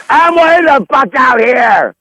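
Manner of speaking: exasperated shout to people far away; shouting across the lot. Real, not acted. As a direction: yell it out across the parking lot. Yelling back across parking lot.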